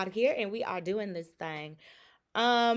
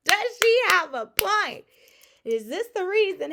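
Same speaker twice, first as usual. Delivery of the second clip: hyped question; 13 semitones higher, 13 dB louder than usual. Real, not acted. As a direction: stretch the question for the chat, hyped. Repeats question to chat excitedly.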